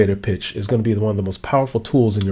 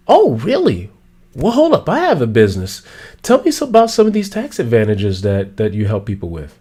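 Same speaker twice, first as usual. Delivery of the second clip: intrigued listener. Acted. Intrigued listener, much higher.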